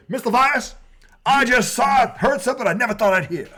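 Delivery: hammed-up principal scolding a teacher; stern principal. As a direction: demand it like an outraged principal. Principal scolding in retold scene.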